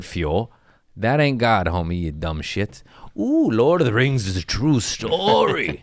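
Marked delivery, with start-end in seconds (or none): 3.2-5.8 lightly voiced awed oracle; mock mystic. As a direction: intone it like a mock prophecy.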